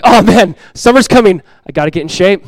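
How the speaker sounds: mock panic. Churchgoer dreading summer, mock panicked. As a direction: fret playfully about getting in shape. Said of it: "You're like".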